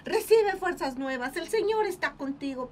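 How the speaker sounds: eager declaration. Pretend. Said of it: "se tirarían a".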